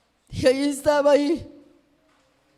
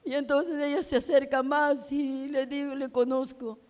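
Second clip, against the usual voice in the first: normal talking voice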